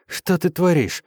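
high woman's shout. A narrator's reading. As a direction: yell the question. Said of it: "крикнула Сус".

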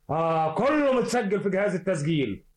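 usual speaking voice

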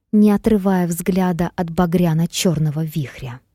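plain reading voice

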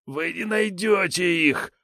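high whiny frightened thug; a cornered petty crook pleading; scared thug voice. A narrator's reading.

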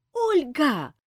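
high, loud, slow shout. A narrator's reading. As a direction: yell for help to hold him. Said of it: "закричала Азиль".

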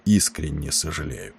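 low, soft, measured regret; stressing every word. A narrator's reading. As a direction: say it quietly and deliberately. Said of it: "выделяя каждое слово".

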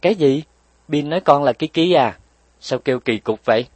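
a mother startled and displeased; mother hearing her child's odd nickname, surprised; frowning surprise. A narrator's reading. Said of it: "Bà Trình cau mày".

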